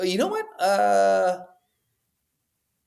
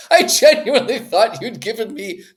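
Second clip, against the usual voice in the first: a touch louder, laughing through the words; 7 semitones higher, 7 dB louder than usual. Real, not acted. Co-host laughs at how short the clip is. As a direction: laugh out the complaint.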